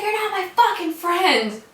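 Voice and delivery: high-pitched retold yell; hysterical retort. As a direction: re-enact lashing out at a roommate. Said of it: "I'm, like, fucking insane".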